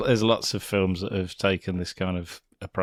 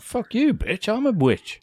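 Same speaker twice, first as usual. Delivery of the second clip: much higher, sassy mock witch voice; mock Glinda; 12 semitones higher than usual. Friend voicing Glinda snapping at Dorothy. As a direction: shriek it like an offended witch. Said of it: "She's like".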